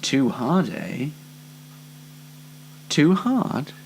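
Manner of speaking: ordinary talk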